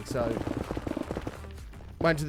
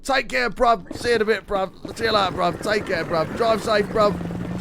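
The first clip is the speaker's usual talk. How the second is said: London bruv goodbyes; 6 semitones higher than usual. Retold or imitated. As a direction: keep calling after him like a London lad. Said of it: "I can just hear him behind me going".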